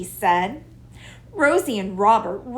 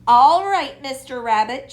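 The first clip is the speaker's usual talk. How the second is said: Rosie calls out to Mister Rabbit across the grass; calling out; 4 semitones higher, 7 dB louder than usual. A narrator's reading. Rosie hails the rabbit from outside his hole, calling. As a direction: call it out, bright and clear. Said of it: "she called".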